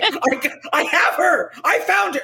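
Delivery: high, slow, laughing radio call; a taxi driver gloating over the radio that the queen is in his back seat, high and laughing; mock taxi driver. A taxi driver on the radio, high and laughing. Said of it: "a taxi driver, you know, on the radio saying".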